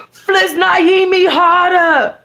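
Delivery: high, slow, loud cry of pleasure; hammed-up cry out. A narrator's reading. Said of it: "I cry out".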